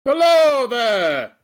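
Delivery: slow booming hello; landlord's hearty shout. Acted. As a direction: bellow a big welcome. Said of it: "a good pub landlord, um, doesn't sound like you do".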